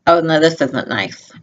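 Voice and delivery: usual speaking voice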